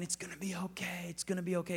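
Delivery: very soft, half-whispered comfort; hushed, fading reassurance; failing father's reassurance. Retold or imitated. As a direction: voice a weakened dad gently reassuring his child. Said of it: "His voice was already starting to change".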